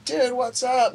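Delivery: bright high call of recognition; excited greeting. Retold or imitated. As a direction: greet an old friend, thrilled. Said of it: "I go".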